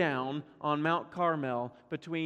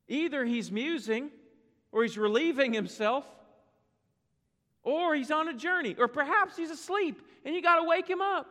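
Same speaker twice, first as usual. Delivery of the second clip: climbing higher and higher in mock jest; sarcastic taunt rising to a high peak; 7 semitones higher than usual. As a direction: pile on the ridicule, building. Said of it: "outright mocking the gods of Baal".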